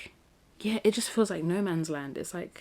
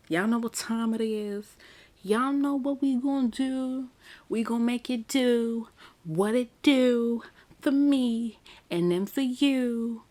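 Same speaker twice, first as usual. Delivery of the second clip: higher, slow, drawn-out hype call; hyped intro call; 7 semitones higher, 3 dB louder than usual. Real, not acted. Calling the viewers into the intro, excited and slow. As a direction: announce the intro like a showman.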